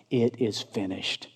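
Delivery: low, soft, solemn statement; hushed quotation. Acted. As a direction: say it quietly, pitch dropped. Quotes last word, later shouted.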